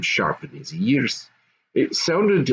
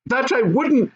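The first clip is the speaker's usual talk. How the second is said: high, firm goblin mother; 14 semitones higher than usual. Acted. Goblin mother agreeing, high and firm. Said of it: "said the first voice".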